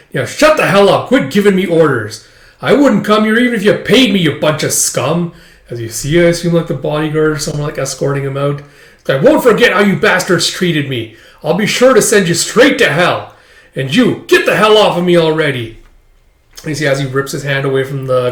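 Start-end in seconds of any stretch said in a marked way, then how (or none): 0.2-5.3 high, loud acted rage; furious quarrelling. 9.1-15.8 raised, loud acted fury; furious threats.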